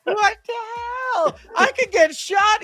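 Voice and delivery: very high, drawn-out comic wail; mock panicked scream. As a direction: act out being shot, hammed-up shock. Acting out Barry getting shot.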